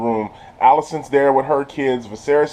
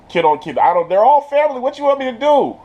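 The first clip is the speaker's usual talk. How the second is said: exasperated protest; 10 semitones higher than usual. Retold or imitated. Guard excusing himself to the king, mock exasperation. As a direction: voice climbing into helpless mock protest.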